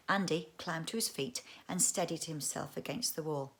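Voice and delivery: ordinary delivery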